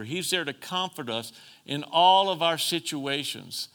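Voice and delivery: usual speaking voice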